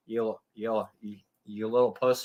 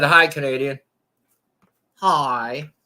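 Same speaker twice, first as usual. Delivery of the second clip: mock Southern; 6 dB louder, slower than usual. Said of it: "That Southern voice".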